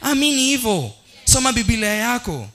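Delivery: chanted pulpit declarations; preaching. Acted. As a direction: hammer each line home. Preaching at full force.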